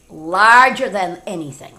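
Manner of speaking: ordinary delivery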